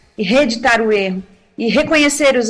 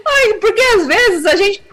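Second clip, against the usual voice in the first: laughing through tears; 6 semitones higher than usual. Real, not acted. Recalling painful family moment.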